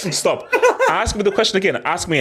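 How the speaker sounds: high, laughing protest. Real, not acted. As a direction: laugh through the words.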